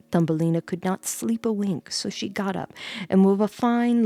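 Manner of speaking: ordinary delivery, nothing marked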